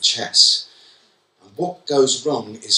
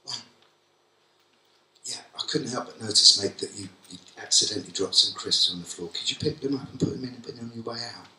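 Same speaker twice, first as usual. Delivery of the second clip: calm, friendly request; 7 dB quieter, faster than usual. Shown as a demonstration. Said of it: "What you should have done".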